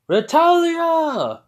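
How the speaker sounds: high, loud drawn-out call; yelled name. Acted. Calling to the girl on the cart, yelling. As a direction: holler the name, stretching it out. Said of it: "I just yell it out".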